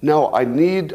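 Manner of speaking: normal talking voice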